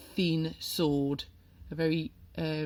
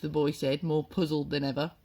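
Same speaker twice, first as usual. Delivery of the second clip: ordinary delivery, nothing marked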